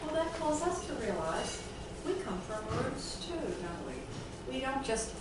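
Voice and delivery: ordinary talk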